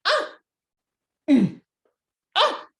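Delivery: wife tugging hard; straining grunts. Acted. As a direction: heave and strain at the stuck turnip.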